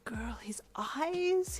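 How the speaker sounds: high, stretched-out squeal of delight. A narrator's reading. Boyfriend seeing the baby's eyes, squealing delight. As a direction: swoon over it, drawing the last word up. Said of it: "he said in delight".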